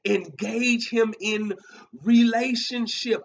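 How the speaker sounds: preacher hammering home "relationship"; emphasis on relationship. Shown as a demonstration. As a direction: lean hard on "relationship" after a pause. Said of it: "I wanna put the emphasis on that word".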